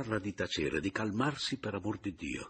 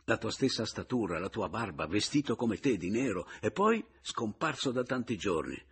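ordinary delivery

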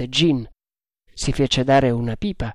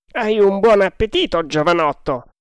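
put-on higher, louder old sea dog; old sailor voice; 6 semitones higher, 4 dB louder than usual. A narrator's reading. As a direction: voice the grizzled boatswain. Boatswain voiced by narrator.

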